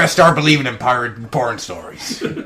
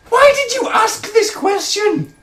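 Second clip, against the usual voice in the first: incredulous; 4 dB louder, slower than usual. Real, not acted. Incredulous high-pitched exchange.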